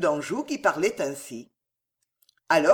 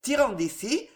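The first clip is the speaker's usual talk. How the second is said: usual speaking voice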